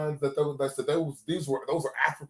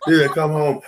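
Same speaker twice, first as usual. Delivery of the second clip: laughing; 5 semitones higher, 10 dB louder than usual. Real, not acted. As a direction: come home through laughter. Laughing repeat.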